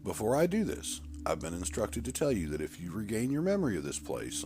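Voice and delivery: a doctor soothing a nervous patient; calm doctor. Acted. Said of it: "I'm Doctor Davidson".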